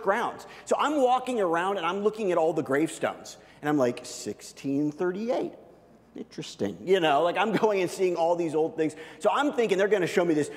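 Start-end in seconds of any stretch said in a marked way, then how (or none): 4.0-6.8 musing. Retold or imitated. Musing over old gravestones.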